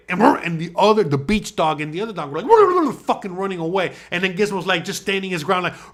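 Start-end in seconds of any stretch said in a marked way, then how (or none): 2.5-2.9 high, loud rolling dog noise; frantic mock barking; imitated dog yelping. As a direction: mimic dogs scrambling away. Imitating dogs running away.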